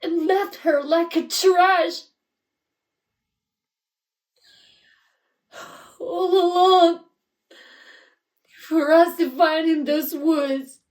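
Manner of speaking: loud, high grief-stricken fury; anguished accusation. Acted. Parent accusing a daughter's killer, anguished fury. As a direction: hurl it at them, loud and high.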